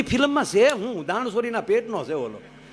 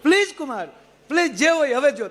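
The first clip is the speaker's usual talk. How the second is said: pleading mother-in-law; 8 semitones higher than usual. Retold or imitated.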